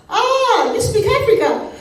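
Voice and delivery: very high, loud exclamation; hammed-up ignorant man's delighted shout; bellowing. A narrator's reading. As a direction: bellow it in comic excitement. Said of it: "he bellowed".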